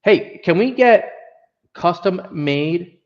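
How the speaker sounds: normal talking voice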